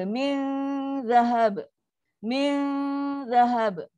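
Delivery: slow "Min", tongue waiting, then release "zahab"; standby dengung. Shown as a demonstration. Teacher shows students standby before the letter zal, held dengung. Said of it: "Ha, so standby je".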